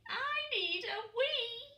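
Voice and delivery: high, urgent chick voice; a tiny penguin needing a wee; child penguin pleading; little penguin voice. A narrator's reading. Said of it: "said Pog".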